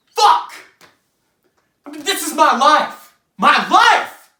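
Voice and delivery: loud, high cry of exasperation; anguished outburst. Acted. Playwright's character railing at his own life, anguished outburst. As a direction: burst out in protest at your own life.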